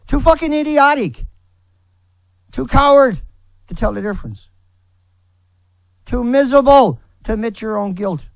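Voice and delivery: enraged outburst; furious yell. Real, not acted. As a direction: scream the insults at them. Ranting at critics.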